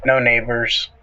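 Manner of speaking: ordinary talk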